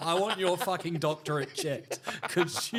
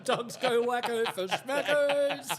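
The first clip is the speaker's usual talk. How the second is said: drawn-out, higher ad-slogan voice; advert slogan; 6 semitones higher, slower than usual. Retold or imitated. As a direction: belt out a cheesy commercial tagline. Mimics an advertising slogan as punchline.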